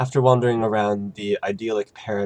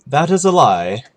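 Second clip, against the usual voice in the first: booming angel voice; 5 semitones higher, 10 dB louder than usual. Acted. Angel bluntly calls out lie.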